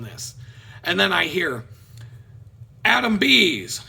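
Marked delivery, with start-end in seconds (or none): 2.8-3.8 higher, louder announcement; imitated courtroom name call; court calling a name. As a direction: call out the next case. Court calls his name, acted.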